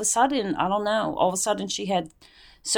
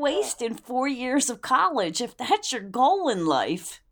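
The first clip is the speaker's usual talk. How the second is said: incredulous scolding; 7 semitones higher than usual. Retold or imitated. Scolding daughter over wasted college.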